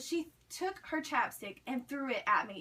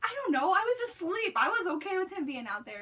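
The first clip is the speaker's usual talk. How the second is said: higher-pitched, just-woken indignation; retold groggy objection, pitched up; exasperated protest; 6 semitones higher, 3 dB louder than usual. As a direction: protest it in a high, whiny rush. Woken, protesting to panicked friend.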